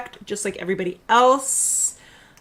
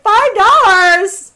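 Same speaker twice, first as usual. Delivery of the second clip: surprised; 10 semitones higher, 11 dB louder, much slower than usual. Retold or imitated. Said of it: "And I was like".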